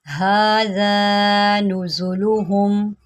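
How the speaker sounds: slow, drawn-out tajweed recitation; Quran recitation. Shown as a demonstration. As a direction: chant the verse slowly, held vowels. Recites the verse.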